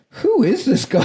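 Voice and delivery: very high, incredulous mimicry; imitated phone answer. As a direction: leap high into a comic quote. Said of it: "the way he answers the phone".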